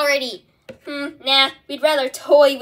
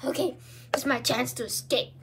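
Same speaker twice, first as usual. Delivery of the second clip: normal talking voice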